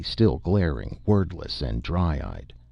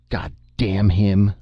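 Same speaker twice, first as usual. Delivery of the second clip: louder, higher, slow furious shriek; shrieking curse; 4 semitones higher, 6 dB louder, slower than usual. A narrator's reading. A boy curses his grandfather for changing the name, shrieking. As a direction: drag out the curse, screaming it. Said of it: "the boy shrieked".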